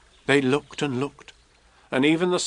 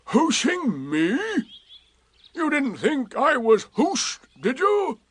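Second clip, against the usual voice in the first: slow, rising, higher disbelief; surprised; 11 semitones higher, slower than usual. A narrator's reading. Eeyore doubting Rabbit's claim, slow and scornful. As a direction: Eeyore scoffing at the idea. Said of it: "said Eeyore in surprise".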